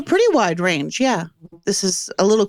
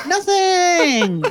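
high laughing echo of the word; 10 semitones higher than usual. Real, not acted. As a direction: laugh as you repeat it. GM echoes the roll, laughing.

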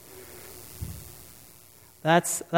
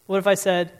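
ordinary talk

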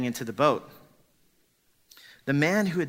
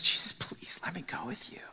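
softer whispered begging; whispered plea; 4 semitones higher, 5 dB quieter than usual. Acted. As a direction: hush the request. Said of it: "he comes and says".

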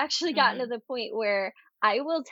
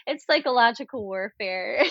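ordinary delivery

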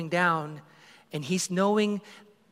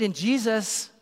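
ordinary delivery

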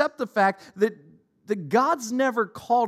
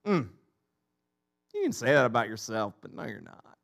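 dismissive Pharisee; 8 semitones lower than usual. Retold or imitated. Pharisees dismissing Jesus' claim.

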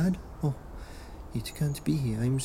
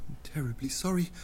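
breathy whispered sorry; whispered apology; 7 dB quieter, faster than usual. Acted. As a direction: whisper it, ashamed.

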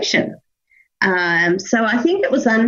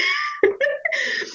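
a sudden shrieking laugh, much higher; high squealing laugh; 5 dB quieter than usual. Real, not acted. Guest laughs at the baby bonus being gamed, high squeal.

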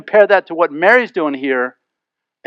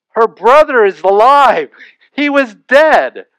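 higher, louder, slow and pressing; emphatic; 5 semitones higher, 5 dB louder, slower than usual. Real, not acted. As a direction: hammer home the miracle. Preacher stresses the miracle.

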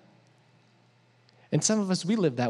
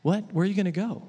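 confused surprise; 4 semitones higher than usual. Retold or imitated. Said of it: "everyone else was like".

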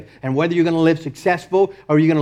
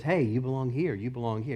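very soft, low coaxing; hushed, insinuating tempter; deceptive voice; 6 semitones lower, 13 dB quieter than usual. Acted. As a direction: lure the listener in quietly, slyly. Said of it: "very deceptive voice that says".

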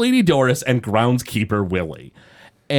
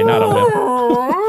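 mock voice; 3 dB louder, slower than usual. Mock Homer whale bit.

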